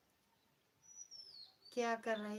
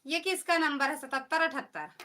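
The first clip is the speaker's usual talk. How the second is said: loud, higher-pitched insistent question; loud demand; 5 semitones higher, 6 dB louder than usual. Real, not acted. Asking the chat whose number this is, loud demand. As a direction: raise your voice to demand an answer.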